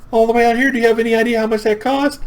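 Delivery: very high, loud squawk of disbelief; fast, hammed-up high-pitched protest; mock outrage. Grandson protests grandma's costly ride, squeaky mock outrage.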